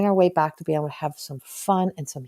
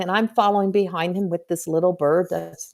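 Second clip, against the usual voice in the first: ordinary delivery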